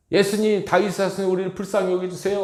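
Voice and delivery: high, loud desperate plea; preacher voicing blind Bartimaeus begging Jesus for mercy, loud; crying out. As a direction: cry out for mercy to a passing crowd.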